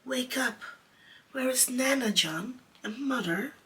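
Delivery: high, pleading calls; crying out. A narrator's reading. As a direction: beg his brother to wake up. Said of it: "Michael would cry".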